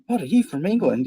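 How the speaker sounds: put-on wide-eyed girl at the mall, high and bright-toned; mock impressed girl. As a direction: play a girl thrilled by a foreign accent. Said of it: "they'd all be like".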